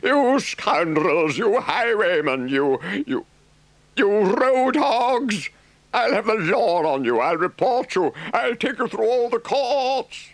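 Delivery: high, loud tirade of insults; furious ranting. Acted. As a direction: shake your fists and rant at them. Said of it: "he shouted".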